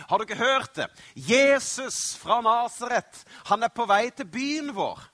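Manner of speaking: loud, high-pitched excited bystander; excited announcement. Acted. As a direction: call out big news to someone. Bystander excitedly announces Jesus is coming.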